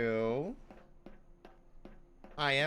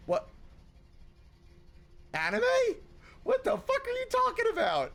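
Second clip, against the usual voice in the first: squeaky, much higher incredulous quote; mock disbelief; 3 dB louder than usual. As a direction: act out shocked confusion. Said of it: "and I'm just like".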